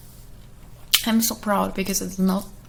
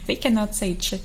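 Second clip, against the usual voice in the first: normal talking voice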